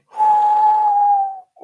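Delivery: long, loud, high theatrical sigh; groaning sigh at a savage joke; exaggerated loud sigh. Real, not acted. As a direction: let out a huge over-the-top sigh. Reacting to a harsh chat insult.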